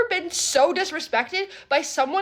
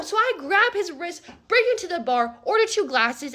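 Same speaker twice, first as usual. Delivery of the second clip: normal talking voice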